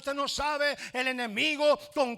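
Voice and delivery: loud, high, near-shouted preaching; preaching at full force. Acted. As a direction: cry it out to the congregation. (predicación a todo pulmón, casi gritando)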